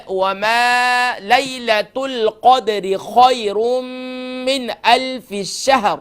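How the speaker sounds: slow melodic tajweed chant; Quran recitation. Shown as a demonstration. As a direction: recite slowly, stretching the vowels. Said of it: "تو لیل". (قرآن کی تلاوت)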